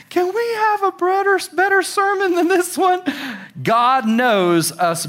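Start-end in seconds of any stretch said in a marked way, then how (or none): 0.1-3.0 much higher, pleading complaint; hammed-up high whine; mock whine. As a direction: whine like a bored listener. Preacher mocks own complaint.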